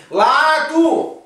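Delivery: full-force high-pitched yell; exasperated shout. Acted. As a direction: scream the answer at a doctor who doubts you. Paciente exasperado grita al oftalmólogo.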